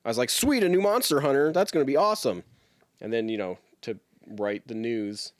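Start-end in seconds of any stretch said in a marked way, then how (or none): 0.3-2.4 excited. Retold or imitated. Retelling his excited reaction.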